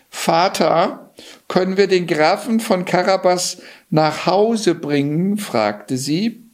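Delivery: high-pitched princess voice; pleading princess. Acted. Princess begging her father to give Johann a lift, pleading.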